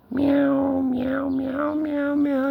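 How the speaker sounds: high chain of put-on meows; mock meowing. Owner meowing for the cat, high mock meows. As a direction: meow repeatedly up high.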